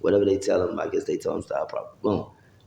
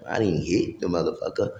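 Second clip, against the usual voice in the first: normal talking voice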